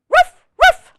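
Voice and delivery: high, loud double woof; puppy woof. Acted. Biscuit answering the reader, high loud puppy woofs. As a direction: yip like an eager little puppy. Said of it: "And Biscuit says".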